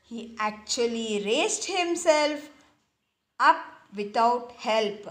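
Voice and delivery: ordinary delivery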